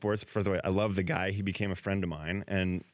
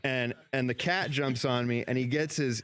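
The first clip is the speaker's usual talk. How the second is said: normal talking voice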